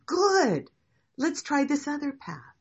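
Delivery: loud, high, drawn-out cheer on the first word; enthusiastic approval. Acted. Teacher answering a student who dislikes a path, loud and delighted. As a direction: pitch it way up, loudly upbeat.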